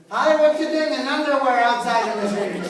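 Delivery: loud call across the street, imitated; calling neighbor. Neighbor lady to man outside in underwear, loud calling. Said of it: "the neighbor said".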